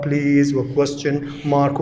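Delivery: ordinary talk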